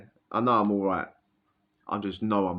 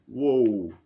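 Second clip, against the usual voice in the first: dizzy whoa. Retold or imitated. High on spice, losing balance.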